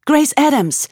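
high, strained voice; tense. Acted. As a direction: say it with a tight, frightened edge. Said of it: "sagte sie in die knisternde Leere".

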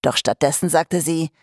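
usual speaking voice

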